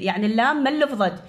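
ordinary talk

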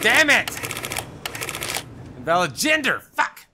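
loud, high angry yell; real anger at a blunder; frustrated outburst. Real, not acted. Gamer cursing his own mistake, angry yell.

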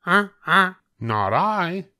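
a goose flatly refusing. A narrator's reading. Goose refusing to help the hen in a high voice. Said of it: "said the goose".

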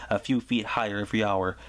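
plain reading voice

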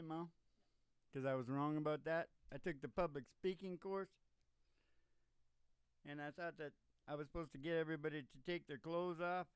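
ordinary talk, nothing marked

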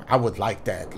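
flat mumbled line. Shown as a demonstration. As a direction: read low and soft, no passion. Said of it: "Show a little bit more, more passion".

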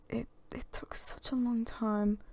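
whispered aside; hushed remark. Real, not acted. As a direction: breathe it out quietly. Aside about slow mod.

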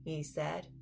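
plain reading voice

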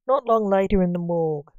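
plain reading voice

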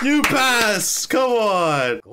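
high, drawn-out exultant call; triumphant cheer. Real, not acted. Reactor celebrating the team passing, triumphant. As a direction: cheer it out as the verdict lands.

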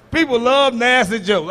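ordinary talk, nothing marked

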